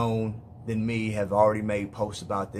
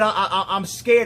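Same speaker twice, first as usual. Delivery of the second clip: high, loud, stammered retelling of fright; imitating distressed fear, pitch raised; retold tearful fear; 7 semitones higher, 6 dB louder than usual. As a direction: stumble into a daughter's scared confession. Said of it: "she's crying and tears are rolling down her face".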